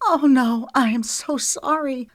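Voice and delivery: high, loud burst of dismay; startled apology. A narrator's reading. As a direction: gasp it out in alarm. She just cut his ear.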